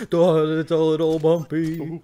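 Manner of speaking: jostled along, stretch it out loud; jolting bumpy-ride voice. Acted. Riding a bike on the grass, loud jolted voice. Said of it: "He goes".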